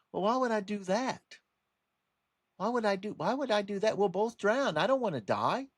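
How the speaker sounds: high-pitched mock-innocent scorpion voice; protesting scorpion. Scorpion assuring a wary frog it won't sting, mock-innocent protest. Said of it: "the scorpion found the frog and said".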